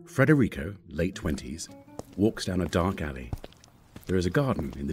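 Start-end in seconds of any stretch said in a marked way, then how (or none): none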